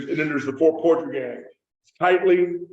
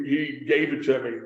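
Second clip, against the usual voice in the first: ordinary talk, nothing marked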